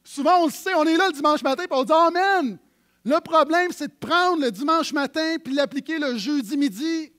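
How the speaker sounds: loud, high-pitched preaching; preaching at full force. Acted. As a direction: proclaim it to the congregation loudly. Prédication à pleine voix. (prédication fougueuse, plus forte et plus aiguë)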